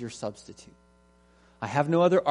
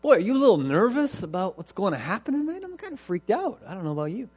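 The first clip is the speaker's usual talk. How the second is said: higher, anxious voice of a worried man; first Hebrew asks his friend if he's nervous, high and anxious; 6 semitones higher, faster than usual. Acted. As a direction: play the jittery neighbour fretting. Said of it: "The first one says".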